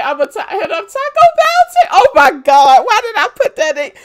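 high loud laughing shriek; squealing excitement. Real, not acted. Friend cracking up about Taco Bell, squealing.

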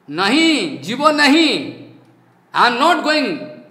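loud, stubborn refusal of thugs; loud mocking imitation of stubborn thugs; defiant shout. Hooligans refusing to leave.

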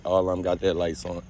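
ordinary delivery

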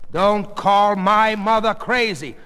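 slow, loud, high rebuke; angry warning. Acted. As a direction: warn him off, word by word. Daughter warns father.

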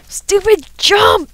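loud, high, drawn-out exasperation; frustrated yell. Real, not acted. Gamer cursing a failed jump, frustrated yell. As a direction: curse the game slowly and loudly.

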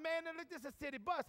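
lightly acted incredulous objection; protesting brother. Brother protests the penguin request.

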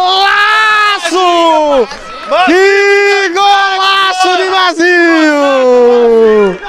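high, long-held shouted golaço; explosive goal commentary. Real, not acted. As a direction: hold every syllable in a soaring yell.

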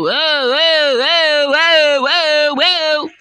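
slow, loud, drawn-out alarmed whoas. Real, not acted. Gamer startled by the enemy, loud drawn-out whoas. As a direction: stretch each whoa in alarm.

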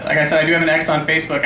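ordinary talk, nothing marked

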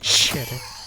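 low quiet slow muttering. Real, not acted. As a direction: mutter a curse under breath. Muttered curse at enemy.

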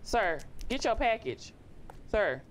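high, slow insistent call. Real, not acted. Courier telling resident to get the package, high insistent call. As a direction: call out high, stretching each word. Calls to resident who ignores delivery.

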